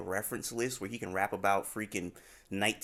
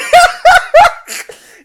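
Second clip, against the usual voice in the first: loud, high burst of laughter; helpless cackling; big laugh; 23 dB louder than usual. Real, not acted. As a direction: crack up uncontrollably. Cracking up at the joke.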